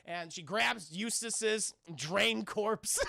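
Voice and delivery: normal talking voice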